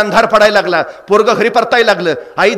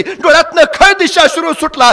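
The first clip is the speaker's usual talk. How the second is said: ordinary delivery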